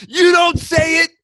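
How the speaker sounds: high, loud tantrum parody; hammed-up shouted demand; mock demanding yell. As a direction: yell it as an insatiable fan. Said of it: "You go like".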